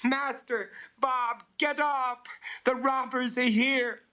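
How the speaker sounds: high urgent shout to wake the house. Acted. Bella shouting upstairs to warn of robbers, high alarmed cry. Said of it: "Bella shouted".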